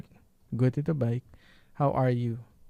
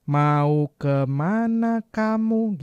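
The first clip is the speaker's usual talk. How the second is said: "Mau ke mana kamu?" drawn out very slowly, higher, each word stretched; over-careful full form; 4 semitones higher, much slower than usual. Shown as a demonstration. Teacher showing how nobody talks, exaggeratedly slow "Mau ke mana kamu?". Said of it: "nggak gitu kan".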